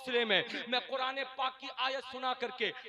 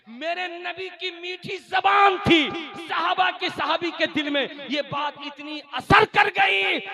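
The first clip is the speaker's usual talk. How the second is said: loud, raised-pitch sermon delivery; full-force preaching; 9 semitones higher, 15 dB louder than usual. Real, not acted. Preacher urges families to learn from the Prophet's household, forceful preaching. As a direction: drive each exhortation out to the crowd.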